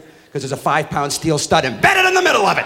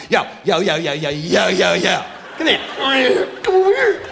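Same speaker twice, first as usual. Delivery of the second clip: mock gangsta call. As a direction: mimic the kid's street talk to mock him. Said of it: "I walk in".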